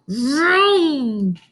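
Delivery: playful vroom noise for the blender. Acted. Teacher voicing the blender for the class, playful vroom. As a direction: make the machine whir.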